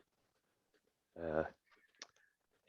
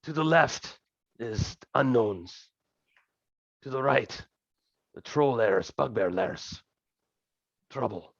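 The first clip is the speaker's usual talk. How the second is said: slow, higher, lightly hushed character voice; a hint of a conspiratorial scout; Cannon's whispered briefing; 4 semitones higher than usual. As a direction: spell out the routes confidentially, in terse pieces. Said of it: "as she whispers to you".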